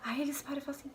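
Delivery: whispered aside, breath only. Real, not acted. Telling viewers what the Americans do next, whispered. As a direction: drop it to a secret whisper.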